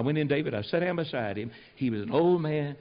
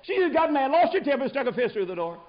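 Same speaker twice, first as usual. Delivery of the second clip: high, loud and amused; imitated laughing explanation; 11 semitones higher, 3 dB louder than usual. Young minister excusing his wife's temper, imitated laughing voice. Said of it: "He laughed".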